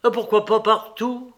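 indignant master; angry cry. A narrator's reading. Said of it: "cria Lévin en colère".

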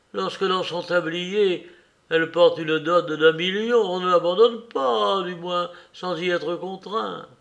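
the mayor reasoning about the seducer; agitated father. A narrator's reading. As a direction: argue it out, still heated.

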